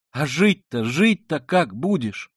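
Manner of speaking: high, loud, slowed appeal; distressed exclaiming. Acted. Sonya challenging his refusal, exclaiming in distress. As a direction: cry out the questions insistently. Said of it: "восклицала Соня".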